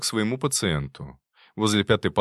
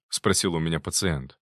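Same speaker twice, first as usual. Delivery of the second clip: usual speaking voice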